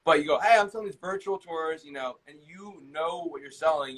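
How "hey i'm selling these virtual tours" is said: higher, confident sales pitch; assured opener with lifted pitch; certain, expert inflection. Shown as a demonstration. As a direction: pitch it like you know you're the expert. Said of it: "you're able to inflect it in your voice".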